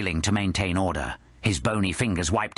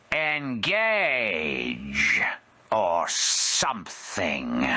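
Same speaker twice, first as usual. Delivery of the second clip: high drawn-out tipsy voice fading to a whisper; drunk woman trailing off; 9 semitones higher, much slower than usual. Acted. Drunk Scamala fizzling out her grand speech to Joe. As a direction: lose the thread mid-speech.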